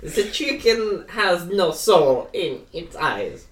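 put-on copied slow, deadpan German accent; Werner Herzog. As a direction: echo the deadpan German demo slowly. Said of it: "He has a very deadpan voice".

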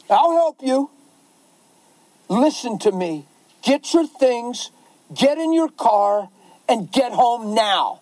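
drawn-out angry command; stern yelling. Acted. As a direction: order the caller firmly, phrase by phrase. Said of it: "when I yelled on the phone".

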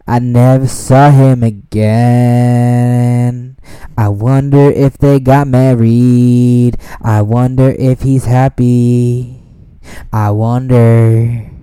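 slow, loud, dreamy mock musing; mock wistful. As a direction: ham up a wistful wonder.

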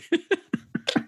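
sudden high burst of laughter. Real, not acted. Host cracking up at his own joke, high laugh.

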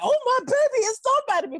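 high, proud mother's exclamation; squealing proud mother; proud mom. Retold or imitated. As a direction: play the mom suddenly impressed. Mom's proud reaction, voiced.

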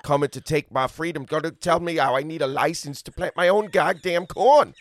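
mock outrage building to a loud finish; ranting farmer. Farmer ranting about needing a licence for corn, mock-indignant and loud.

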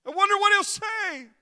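high, full-force preaching cry; loud, soaring pulpit shout; preacher's shout. Real, not acted. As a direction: throw the question out at the top of your voice. Preacher cries out rhetorical question.

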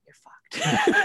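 laughing high and bright. Retold or imitated. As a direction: blurt it through a laugh. Mom's verdict, laughing.

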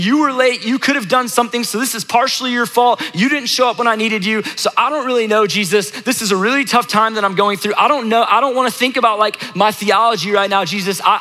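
high-pitched, upset venting at Jesus; imagine a grieving sister complaining, words tumbling out; pleading, frustrated outburst, pitched well up; exasperated Martha. Acted. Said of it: "I'm sure Martha was like, "Jesus".

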